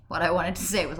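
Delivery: ordinary delivery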